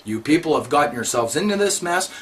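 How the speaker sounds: ordinary talk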